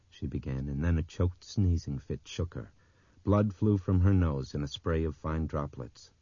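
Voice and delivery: usual speaking voice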